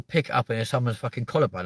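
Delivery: normal talking voice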